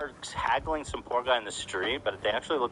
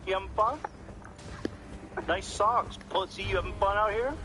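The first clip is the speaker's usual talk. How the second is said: higher, taunting imitation of a smug bully; mocking heckler; 8 semitones higher than usual. Host imitating the hecklers taunting a man, mock sneering. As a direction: sneer it up like the street pranksters.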